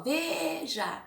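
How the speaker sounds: slow veja, VE held long; stress on VE. Shown as a demonstration. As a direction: stretch out the VE of veja. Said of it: "vocês vão pronunciar".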